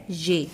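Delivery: "ये" as a clear "zhe"; correct letter name. Shown as a demonstration. Teacher repeating the letter for pupils, "ये" pronounced zhe. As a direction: say "ये" with zh and a long e. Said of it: "इस हर्फ को पढ़ा जाता है".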